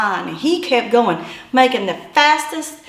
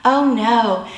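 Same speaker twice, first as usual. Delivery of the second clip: ordinary talk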